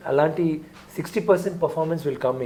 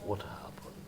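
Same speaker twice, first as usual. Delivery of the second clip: breathy menacing whisper; villain whisper; 19 dB quieter, much slower than usual. Retold or imitated. Actor showing how villain Love says it, whispered. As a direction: whisper it low and cold. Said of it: "his voice will be like".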